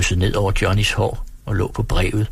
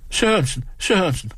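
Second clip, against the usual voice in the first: higher, calling after the apprentice; 9 semitones higher than usual. Acted. Hostess calling after the kitchen apprentice, flustered. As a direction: call him back in a fluster. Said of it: "råbte fru Brasen efter eleven".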